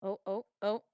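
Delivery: ordinary delivery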